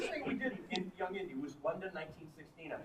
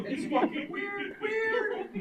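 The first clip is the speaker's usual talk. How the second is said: Walken pauses; 13 semitones higher, 8 dB louder, much slower than usual. Retold or imitated. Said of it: "the fucking pauses are still there".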